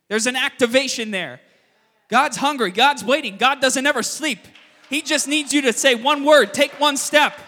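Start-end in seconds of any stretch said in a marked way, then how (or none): 2.1-7.4 louder, building to a shout; preaching shout. Acted. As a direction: drive the call home from the pulpit. Preaching climax.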